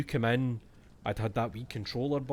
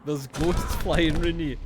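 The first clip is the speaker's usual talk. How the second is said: louder, slow laughing shout; 5 semitones higher, 10 dB louder, slower than usual. Real, not acted. Gamer yelling at his character about ghosts, laughing.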